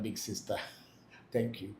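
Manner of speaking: ordinary delivery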